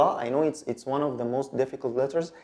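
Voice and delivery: ordinary talk, nothing marked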